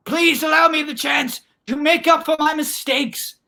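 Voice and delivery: high-pitched, hammed-up desperate prayer; melodramatic pleading. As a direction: beg the heavens, theatrically. Girl pleads to God in story.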